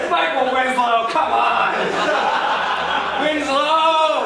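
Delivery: exasperated call. Retold or imitated. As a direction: yell his name like you've been pranked. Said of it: "and I'm like".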